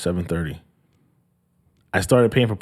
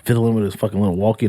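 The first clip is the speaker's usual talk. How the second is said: ordinary talk, nothing marked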